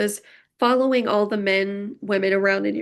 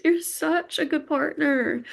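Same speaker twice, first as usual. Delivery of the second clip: touched and welling up, pitched up; moved almost to crying; higher voice, overcome with feeling; emotional, near tears; 6 semitones higher than usual. Real, not acted. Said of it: "I'm so emotional".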